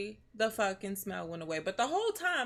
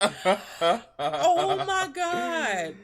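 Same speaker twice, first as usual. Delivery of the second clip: laughing; 7 semitones higher, 6 dB louder, much slower than usual. Real, not acted. Laughing at partner's denial.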